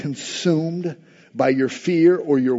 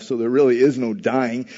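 ordinary talk

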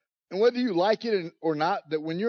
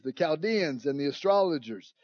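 usual speaking voice

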